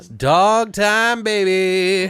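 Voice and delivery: high, slow, drawn-out sing-song; sing-song tease. Pretend. Teasing his partner about the movie dog, high and slow. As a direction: coax it like a baby-talk tease.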